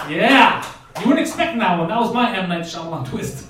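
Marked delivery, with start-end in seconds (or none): 0.1-0.6 loud, punched cheer; triumphant shout. Real, not acted. Host celebrates his surprise team reveal, triumphant shout. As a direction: shout it in victory.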